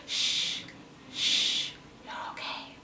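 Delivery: soft breathy hush, barely audible; whisper. Retold or imitated. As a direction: hush someone like a ghost at your ear. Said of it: "as if someone was whispering in my ear".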